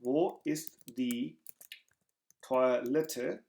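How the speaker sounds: very slowly, word by word; slow German demo. Shown as a demonstration. Teacher slowly models the phrase for students. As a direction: spell it out slowly for learners. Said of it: "कहाँ है toilet?".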